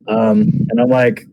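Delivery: ordinary talk, nothing marked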